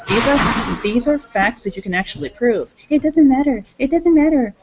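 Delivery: high, loud and emphatic retort; heated quoted argument. Retold or imitated. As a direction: insist loudly, as if arguing back. Retells arguing with someone, quoted.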